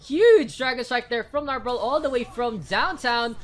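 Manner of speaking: loud, excited esports call; hype casting. Acted. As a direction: build the hype as the team falls. Hype play-by-play.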